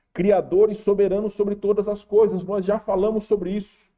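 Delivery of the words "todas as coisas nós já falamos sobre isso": raised, fervent preaching. Acted. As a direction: preach it with rising fervor. Preaching at full force.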